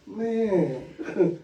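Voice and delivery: ordinary talk, nothing marked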